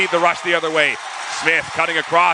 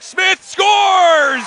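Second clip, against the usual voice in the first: higher, louder goal shout; goal call; 7 dB louder than usual. Real, not acted. Goal call in hockey play-by-play.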